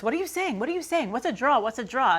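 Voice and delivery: repeated mock-confused questions; baffled commenters. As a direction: imitate a pile of puzzled commenters. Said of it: "getting all of these comments".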